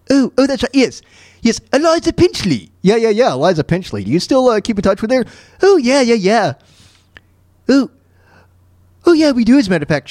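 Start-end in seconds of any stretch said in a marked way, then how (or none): 0.1-1.0 high cartoon voice, suddenly remembering; Stewie. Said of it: "Well, Stewie". 1.4-2.7 very high, affected cartoon voice; Stewie. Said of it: "Well, Stewie". 5.6-10.1 high, eager cartoon voice; Stewie. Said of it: "Well, Stewie".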